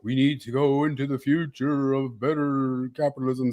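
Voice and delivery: imitating an echoing voice-over; mock-serious echo voice, lightly done. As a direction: pretend your voice is echoing. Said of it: "goes into echo voice".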